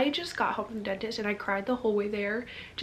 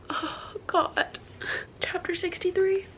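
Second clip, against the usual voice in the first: whispered dread; 8 semitones higher, slower than usual. Real, not acted. Dreading the final chapter.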